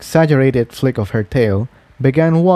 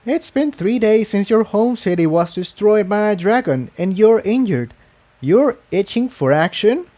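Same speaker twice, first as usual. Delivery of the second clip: higher, disbelieving pegasus voice; incredulous Tiptoe; 9 semitones higher than usual. Acted. Tiptoe challenging her injured friend, incredulous and higher. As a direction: scold her friend, half amazed, half worried.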